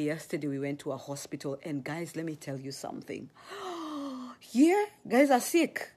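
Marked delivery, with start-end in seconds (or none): 3.4-4.4 shocked gasp. Retold or imitated. Shocked at sick people. 4.4-5.9 shocked exclaim. Retold or imitated. Shocked at hospital patients.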